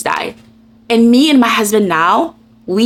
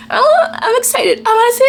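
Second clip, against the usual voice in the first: much higher, slowed giddy squeal; 14 semitones higher than usual. Real, not acted. Giddy about husband's book.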